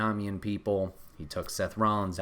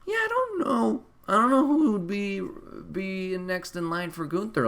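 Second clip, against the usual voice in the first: puzzled high voice; 10 semitones higher than usual. Retold or imitated. Telling his friend he has no idea who's next, in a high baffled voice. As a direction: shrug it off in a high put-on voice. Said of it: "And I'm like".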